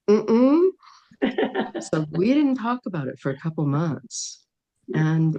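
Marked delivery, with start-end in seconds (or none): 0.1-0.7 high, loud closed-mouth no; firm refusal. Retold or imitated. Freaked-out daughter refusing her mother, loud high mm-mm. 1.2-1.7 breathy laugh. Real, not acted. Laughs after daughter's refusal.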